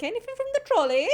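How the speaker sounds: high, quick mock vendor call; trolley seller's call. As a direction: sing out an offer like a trolley attendant. Imitates a trolley seller calling out.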